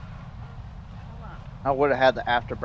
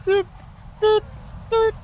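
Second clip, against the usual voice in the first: imitating beeping. Shown as a demonstration. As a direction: chirp out three sharp beeps like an alarm. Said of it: "I got just a default".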